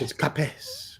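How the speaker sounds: "capes" said as two syllables, kah-PESS; mock-Spanish "capes" as kah-PESS, stretched out; Spanish pronunciation. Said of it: "In Spanish".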